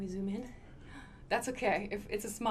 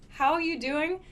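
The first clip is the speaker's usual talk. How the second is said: higher, louder upbeat question; cheerful greeting; 6 semitones higher, 5 dB louder than usual. Shown as a demonstration. Teacher models a cheerful how are you. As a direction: greet a friend brightly. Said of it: "That's a great way to respond".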